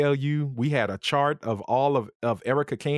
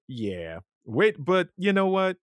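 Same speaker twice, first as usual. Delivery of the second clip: ordinary delivery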